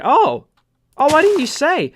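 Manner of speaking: high-pitched mock girlfriend voice; girlfriend's sudden realisation. Girlfriend finally understanding his explanation, mock innocent. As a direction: put on a high, innocent-sounding reply.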